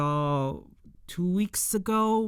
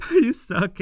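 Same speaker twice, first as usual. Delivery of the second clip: louder mock retort; 5 semitones higher, 6 dB louder than usual. Fan relishing the character's rude retort, louder and higher. Repeats the line louder after laughing.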